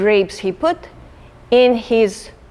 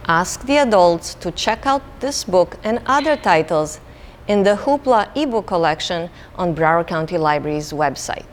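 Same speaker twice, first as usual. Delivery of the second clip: usual speaking voice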